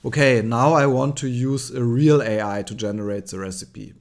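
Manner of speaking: normal talking voice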